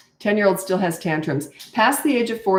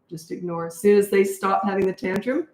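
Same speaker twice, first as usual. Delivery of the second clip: ordinary talk, nothing marked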